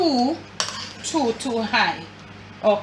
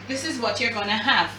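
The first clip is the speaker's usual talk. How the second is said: ordinary talk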